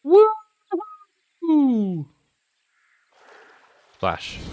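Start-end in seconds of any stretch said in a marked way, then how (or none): 0.0-2.0 high whoop. Real, not acted. Whooping in game.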